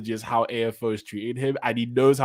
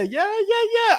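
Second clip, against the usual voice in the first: much higher, excited squeal; giddy high-pitched yeahs; 5 dB louder than usual. Real, not acted. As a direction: squeal it with delight. Delighted at a joke.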